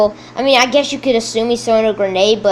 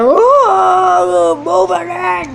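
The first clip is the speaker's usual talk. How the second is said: much higher, loud, shrill shout fading on the order; mock screaming soldier; 9 semitones higher, 5 dB louder, much slower than usual. Screaming toy soldiers yell the order in a shrill panic. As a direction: squeal the command like a frantic private.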